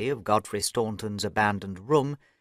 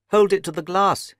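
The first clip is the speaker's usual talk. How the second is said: louder and higher, urging; excited cry; 7 semitones higher, 6 dB louder than usual. A narrator's reading. Overton urging Holmes about the paper, excited cry. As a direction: cry out the suggestion eagerly. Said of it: "he cried".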